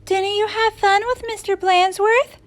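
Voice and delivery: high-pitched sweet teacher; high, lilting question; returning teacher. A narrator's reading. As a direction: ask the class with feigned innocence. Sweet teacher asks class.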